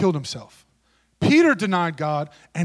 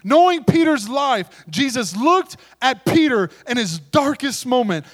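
preaching at full force; 3 semitones higher, 5 dB louder than usual. Acted. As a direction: drive it home to the congregation. Preaching climax to church.

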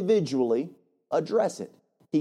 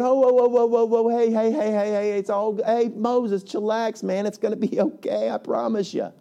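put-on calm him down like a caught kid; nervous brother pleading to chill; Aaron placating Moses; 5 semitones higher than usual. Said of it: "when we see Aaron going".

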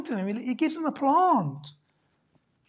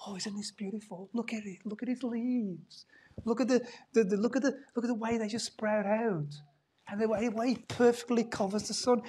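soft, admiring, much quieter; gushing gardener; 7 dB quieter than usual. Acted. A plant lover admires the vine's leaves, soft and doting. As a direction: coo over the plant like a gardener. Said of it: "well, what are they like?".

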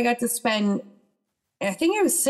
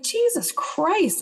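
higher, drawn-out exclamation of disbelief; exasperated disbelief; 9 semitones higher, much slower than usual. Retold or imitated. Telling of losing longtime friends over politics, exasperated disbelief. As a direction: draw it out high, appalled at what you heard. Retelling stunned reaction to friends' attacks.

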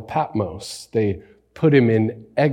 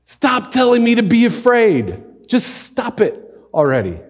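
exasperated outburst; 12 semitones higher, 4 dB louder than usual. Real, not acted. Preacher venting at fearmongering media.

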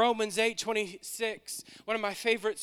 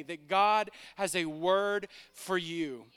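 usual speaking voice

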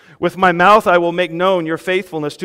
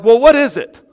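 louder, higher puzzled demand; exasperated question; 6 semitones higher, 8 dB louder than usual. Retold or imitated. Reader baffled by the psalm, asking loud and high in exasperation. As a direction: throw up your hands in confusion. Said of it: "And you're like".

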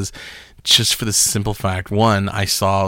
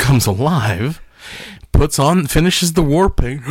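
normal talking voice

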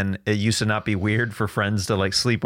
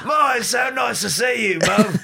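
high-pitched mock London boy; put-on London accent; 9 semitones higher than usual. Boy home from sleepover to mum, high London accent. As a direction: overdo the English accent, pitched up. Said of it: "slipping into his old accent".

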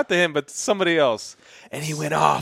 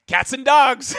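mock shout; 8 semitones higher, slower than usual. Imitating Kyle walking in from the rain, high mock shout. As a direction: belt it out like a weatherman gag. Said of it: "I saw Kyle's like".

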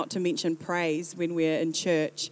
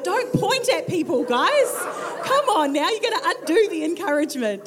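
high, loud, amused telling-off; laughing mock scolding; 9 semitones higher, 5 dB louder than usual. Real, not acted. Preacher teasing people who point at others, laughing. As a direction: scold them playfully through laughter.

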